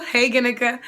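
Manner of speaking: ordinary delivery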